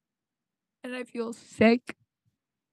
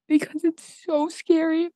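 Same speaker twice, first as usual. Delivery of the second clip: tearful confession of fear; words wobbling on the edge of a sob; crying; 6 semitones higher than usual. Real, not acted. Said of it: "I'm crying".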